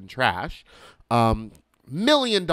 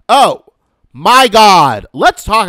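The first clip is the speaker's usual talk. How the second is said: loud, high gush of excitement; excited exclamation; 9 semitones higher, 13 dB louder, slower than usual. Real, not acted. Remembering Santee Alley shopping, loud excited gush. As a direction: burst out thrilled.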